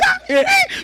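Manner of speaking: high, squealing mock exclamation of delight; mock excited cry. Preacher mimics relatives greeting a returnee, high squeal. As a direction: imitate greedy relatives seeing money.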